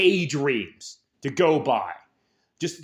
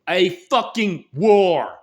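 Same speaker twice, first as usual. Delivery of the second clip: slow and louder, hammering each word; emphatic shout; 3 semitones higher, 7 dB louder, much slower than usual. Real, not acted. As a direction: punch out each word slowly and loudly. Ranting call to war.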